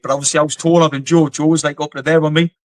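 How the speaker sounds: ordinary talk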